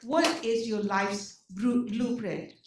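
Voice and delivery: ordinary delivery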